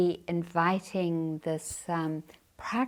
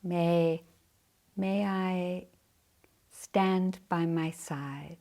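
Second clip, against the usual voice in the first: guided meditation. Acted. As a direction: guide the sitters gently into practice.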